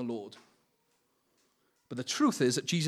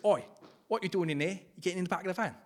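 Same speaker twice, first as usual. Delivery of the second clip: lightly put-on Cockney preacher; Cockney accent; 3 semitones higher, faster than usual. Imitating a Cockney preacher ordering thoughts out, Cockney accent. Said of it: "I'll go for the Cockney accent".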